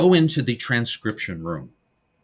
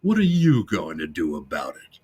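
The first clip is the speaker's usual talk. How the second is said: low, challenging tough-guy voice; menacing recorded voice; 7 semitones lower than usual. Retold or imitated. Recording answering Lou back, in a low threatening voice. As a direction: drop deep and dare him. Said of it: "And the recording goes".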